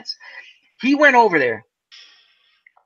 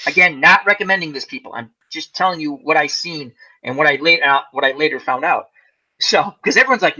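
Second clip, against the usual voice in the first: ordinary talk, nothing marked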